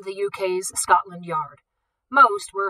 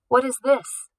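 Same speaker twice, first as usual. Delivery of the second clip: loud, high demand; 6 semitones higher, 6 dB louder, slower than usual. A narrator's reading. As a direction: demand an answer. Director arrives angry.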